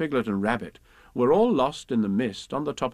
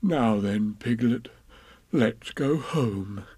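slow Pooh voice; 2 semitones lower, much slower than usual. Acted. Pooh voicing.

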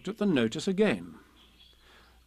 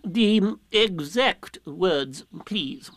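very slow owl voice, pitched up; drawn-out demand for precision; insistent, pompous Owl; 4 semitones higher, much slower than usual. Acted. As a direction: repeat it ponderously, ignoring the reply. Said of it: "as if Rabbit hadn't spoken".

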